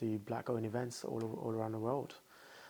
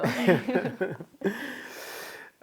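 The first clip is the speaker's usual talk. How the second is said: high bright burst of laughter at the question; amused laugh; 10 semitones higher than usual. Real, not acted. Guest laughs when host asks about being a troublemaker. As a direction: laugh it off, caught out.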